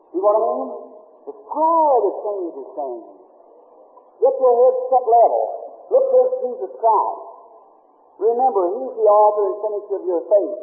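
high, ringing exhortation, slowing on key lines; preaching at full force. Acted. Preacher urging the sick to believe God heals, at full force. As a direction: urge the listeners to have faith.

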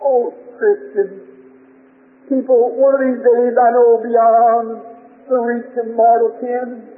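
slow, drawn-out, hymn-like recitation; fervent preaching. Real, not acted. Preacher tells his people of the heaven to come, fervent preaching. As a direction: stretch each phrase out slowly with yearning.

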